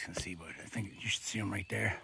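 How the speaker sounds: hushed aside; whispering. Real, not acted. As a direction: drop to a whisper. Aside while climbing.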